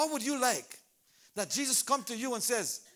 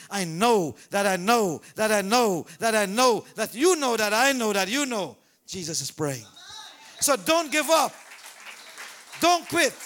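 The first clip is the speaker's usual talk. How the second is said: preaching at full force; 9 dB louder than usual. Acted. Preacher urging the congregation, full-force preaching. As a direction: preach it loud and hard.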